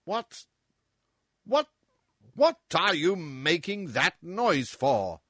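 high, slow, angry questioning; furious demand. Acted. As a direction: round on him in fury. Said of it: "demanded the king, turning furiously".

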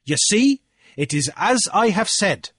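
high, loud declaiming challenge; Cornelius, triumphant orator. A narrator's reading. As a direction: orate forcefully to a whole assembly. Cornelius presses his point.

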